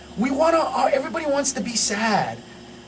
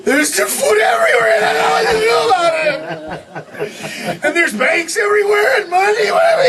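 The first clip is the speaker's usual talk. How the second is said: mock wailing complaint; 6 semitones higher than usual. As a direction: bawl in hammed-up despair over good fortune. Mocking spoiled Americans complaining of abundance.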